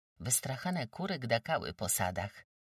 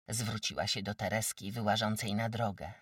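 ordinary delivery

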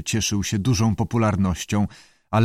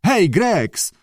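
calling out; 8 semitones higher, much slower than usual. A narrator's reading. Director calls for the keeper, high urgent call. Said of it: "wrzasnął sir Charles".

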